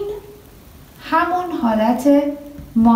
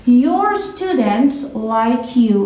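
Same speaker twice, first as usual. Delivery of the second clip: very slow, words spaced out; slow example reading; much slower than usual. Shown as a demonstration. Teacher showing the possessive example, very slowly. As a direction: read it slowly for the class. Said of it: "your آپاستروفی وجود نداره".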